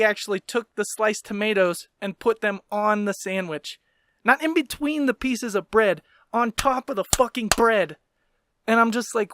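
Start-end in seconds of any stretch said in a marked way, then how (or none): none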